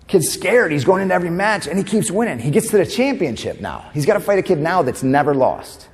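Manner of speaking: normal talking voice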